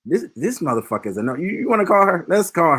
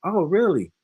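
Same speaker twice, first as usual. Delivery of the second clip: high, impressed "Oh, really?"; impressed surprise; 8 semitones higher, slower than usual. Retold or imitated. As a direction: mimic her being impressed, pitched up. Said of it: "And she was like".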